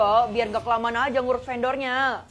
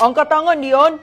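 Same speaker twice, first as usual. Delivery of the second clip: loud, high order to freeze; police shout; 5 semitones higher, 11 dB louder, slower than usual. Acted. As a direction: bark the arrest command. Police arrest the villain. (polisi berteriak keras menyuruh angkat tangan)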